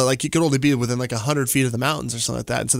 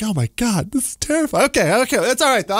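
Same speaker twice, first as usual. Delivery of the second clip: panicked; 7 semitones higher than usual. Retold or imitated. Panicked self in dream.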